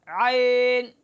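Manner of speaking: عین said muffled and unclear; wrong vague ain. Shown as a demonstration. Teacher demonstrating the vague wrong عین. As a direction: blur the عین. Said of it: "یا مجہول پڑھنا عین".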